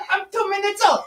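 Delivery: high shouted squeak; rat baby voice. Acted. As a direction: yell as a newborn rat.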